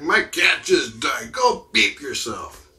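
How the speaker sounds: loud, high, drawn-out mock-angry retort; hammed-up outrage, hitting each word hard; mock indignant outburst. Snapping back at someone who says to smile, mock indignant.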